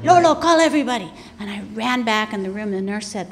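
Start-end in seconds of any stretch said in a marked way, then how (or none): 0.0-1.1 calling out loud and fast to her brother; urgent shout. Retold or imitated. Retold urgent call to brother.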